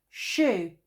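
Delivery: loud desperate shoo; scream. A narrator's reading. Bear, chased by bees, screams loudly at them. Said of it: "screamed Bear".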